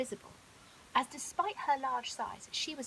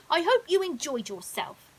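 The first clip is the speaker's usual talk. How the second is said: louder, sulky and cross; soaked lion grumbling at his friend; grumpy; 5 semitones higher, 10 dB louder than usual. Acted. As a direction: grumble it at the crocodile who tricked him. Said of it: "said Leo grumpily".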